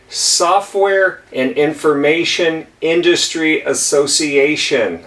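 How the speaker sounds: slow, careful word-by-word delivery. Real, not acted. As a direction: take the tongue twister slowly so as not to trip up. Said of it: "I have to say that very slow".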